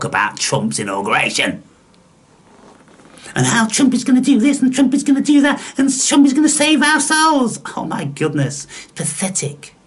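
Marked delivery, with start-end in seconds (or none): 3.4-7.6 high, fast mock excitement; mocking believers. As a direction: rattle it off in a silly high voice. Mimics Trump's hopeful believers.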